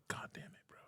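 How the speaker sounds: voiceless mutter of dismay; muttered under breath. Retold or imitated. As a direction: barely breathe it out, stung by the price.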